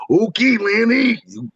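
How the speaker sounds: slow, high raspy wrestler drawl; Randy Savage voice. Retold or imitated. Caller answers Lanny on air in a raspy Randy Savage drawl. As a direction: stretch it out like the Macho Man. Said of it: "I start doing Randy's voice".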